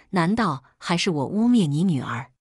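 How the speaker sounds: plain reading voice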